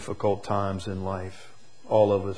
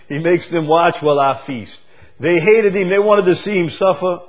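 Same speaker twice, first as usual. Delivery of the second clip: rising preacher's voice, louder; preaching; 7 semitones higher, 9 dB louder than usual. Acted. Preacher lifting his voice to the congregation. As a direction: preach it out with force. Preacher exulting over enemies.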